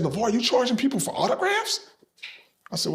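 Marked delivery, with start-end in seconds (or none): 0.0-1.8 mock girl's question. Girl questions him at signing.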